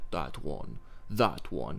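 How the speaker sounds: low, quiet, cold voice; boss choosing girls. Retold or imitated. Boss choosing kidnapped girls, low quiet cold voice. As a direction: pick them out like a cold slaver. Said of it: "this boss guy, like".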